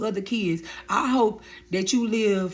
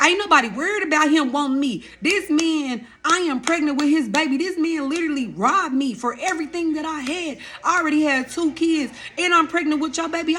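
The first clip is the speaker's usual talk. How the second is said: heated rant; 8 semitones higher than usual. Retold or imitated. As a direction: go off on him, voice up high. Furious retort about the ex.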